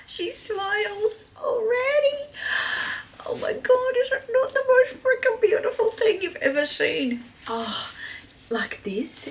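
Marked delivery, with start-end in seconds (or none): 0.1-7.2 high, almost crying joy; high-pitched tearful gushing; high teary delight. Real, not acted. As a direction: gush with joy near tears. Overjoyed at the bag.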